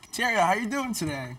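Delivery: excited greeting. Real, not acted. Host greets guest on show.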